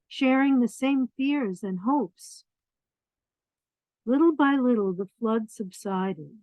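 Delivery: lively storybook reading; reading to children. A narrator's reading. Reading a picture book to children, lively storybook voice. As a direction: read it out warmly to the little ones.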